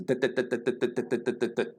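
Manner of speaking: machine-like string of identical "dit" beats; uniform robotic dits. Shown as a demonstration. Teacher sounding out syllable timing, robotic even dits. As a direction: tap out evenly spaced, equal "dit" syllables. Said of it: "it's much more uniform".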